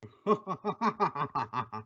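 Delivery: soft, echoing sinister laugh from nowhere; eerie mocking laugh. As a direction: laugh like an unseen avenger. The Shadow reveals himself.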